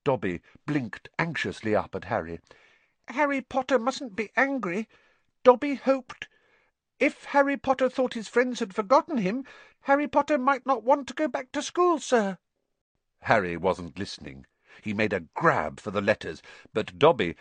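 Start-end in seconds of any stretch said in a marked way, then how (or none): 3.1-12.4 high, anxious house-elf voice; a fretful little elf pleading. Acted. Dobby's elf voice.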